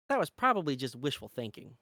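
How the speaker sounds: ordinary delivery, nothing marked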